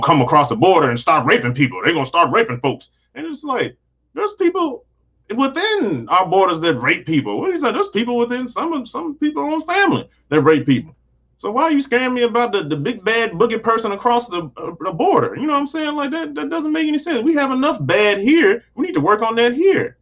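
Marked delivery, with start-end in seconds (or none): 3.1-12.6 indignant high pitch. Real, not acted. Ranting to viewers about fear-mongering, high and incredulous. 13.0-20.0 indignant high pitch. Real, not acted. Ranting to viewers about fear-mongering, high and incredulous.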